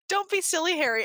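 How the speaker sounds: lightly voiced stern aunt ordering; commanding aunt. Aunt ordering Harry not to be silly, lightly commanding. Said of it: "Aunt Petunia commanded".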